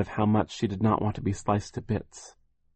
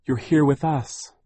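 higher, slow, relieved sister; slow and higher, letting the worry go; 7 semitones higher, 5 dB louder than usual. Acted. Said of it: "Violet said in relief".

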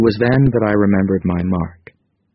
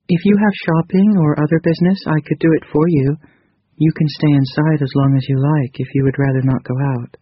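higher, gentle female character voice; 8 semitones higher than usual. A narrator's reading. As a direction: voice Krita Kano lightly higher. Narrator voices Krita Kano.

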